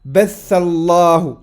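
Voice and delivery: Batha Allahu said loud and deliberate, stress on batha; clear Arabic model reading. Shown as a demonstration. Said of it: "teacher models Arabic reading".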